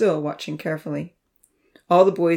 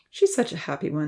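plain reading voice

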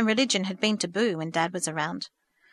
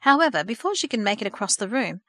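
ordinary delivery

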